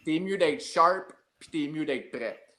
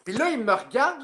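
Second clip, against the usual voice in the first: usual speaking voice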